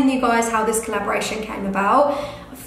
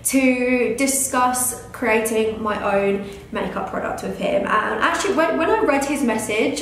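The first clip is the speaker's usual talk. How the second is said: usual speaking voice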